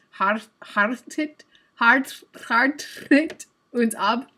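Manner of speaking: hartet very slowly with a rolled R; effortful rolled R. Shown as a demonstration. Streamer practising her German, straining to roll the R in hartet. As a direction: struggle loudly to trill the R in hartet. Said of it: "I have to roll my R".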